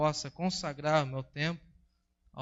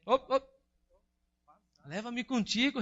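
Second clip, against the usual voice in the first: high, loud desperate plea; Love begs Wealth from the water, loud and high; pleading call; 7 semitones higher, 3 dB louder than usual. Acted. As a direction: call out higher and louder, begging. Love begging Wealth for rescue.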